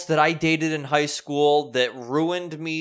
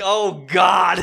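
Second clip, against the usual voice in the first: loud, raised cry of retold dread; mock dismay; 5 semitones higher, 8 dB louder than usual. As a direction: exclaim it high, as if trapped with her. His dismayed reaction, retold.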